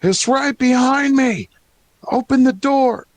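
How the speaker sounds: high, loud, drawn-out scream of alarm; panicked gnome screaming. Acted. Fleeing gnome screaming at the party to open up, panicked scream. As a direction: scream a warning as you flee in terror.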